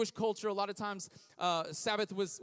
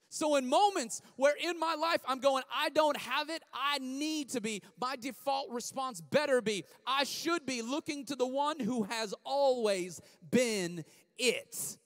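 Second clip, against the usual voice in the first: preaching; 6 semitones higher, 5 dB louder than usual. Real, not acted. Preaching build.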